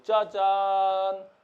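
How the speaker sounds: very slow, stretched reveal; drawn-out ta-da. Real, not acted. Teacher revealing a circuit answer to class, drawn-out ta-da. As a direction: hold the last syllable long.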